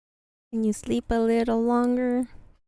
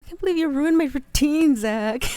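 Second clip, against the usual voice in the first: higher, louder playful outrage; mock accusation; 4 semitones higher, 6 dB louder than usual. Blaming Zach for a ruined routine, mock accusation. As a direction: tease with a mock-indignant complaint.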